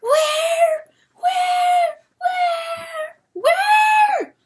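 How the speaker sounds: very high, loud screeching cry; a siren-like call dragged out across the sea; rooster's shriek. Acted. Chanticleer crying across the sea, shrill endless shout. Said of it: "resolved into a living voice".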